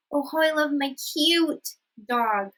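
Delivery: high-pitched doting stress on "cute"; gushing emphasis. Shown as a demonstration. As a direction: draw out "cute" adoringly. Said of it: "So here we're emphasizing".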